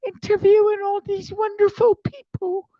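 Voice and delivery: hammed-up whiny mom pleading; imitating his mom. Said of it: "And she's like".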